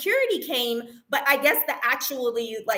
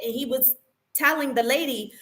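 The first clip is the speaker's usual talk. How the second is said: usual speaking voice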